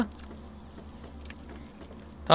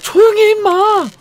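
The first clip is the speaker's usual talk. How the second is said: slow, much higher yell to shut it up; exasperated shout; 13 semitones higher, 4 dB louder than usual. Real, not acted. Gamer yelling at the creature to hush, exasperated shout. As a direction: yell as if it'll get you caught.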